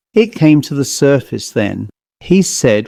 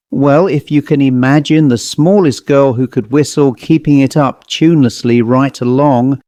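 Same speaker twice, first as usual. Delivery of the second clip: plain reading voice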